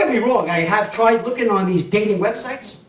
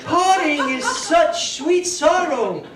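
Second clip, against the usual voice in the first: higher, slow theatrical sigh; mock Shakespearean; 8 semitones higher, much slower than usual. Quoting Shakespeare to the crowd, higher slow theatrical sigh. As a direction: recite it like a tragic heroine. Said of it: "what about this one too".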